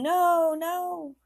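high-pitched mock wail; imitated hollering. A narrator's reading. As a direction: imitate distant screams without full volume. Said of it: "people screaming and hollering".

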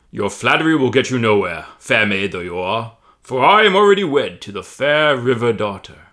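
loud, grand giant voice; pompous booming voice. Acted. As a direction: declaim it pompously. Tom voiced.

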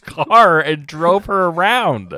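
high, loud, incredulous scolding; exasperated shouting. Real, not acted. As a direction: spell out the obvious to a reckless friend. Friend scolds him for helping stranger.

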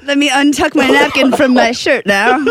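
a light hint of a posh British accent; mock British. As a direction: savor the sandwich in a playful British accent. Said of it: "She turned British".

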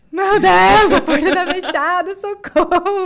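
high, loud laughing panic; laughing mock alarm; panicked shout. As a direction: replay the panic at the locked door. Locked hospital door during labour.

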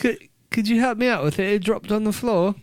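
put-on high English-accented voice; mock English accent. Carries on the English doctor bit.

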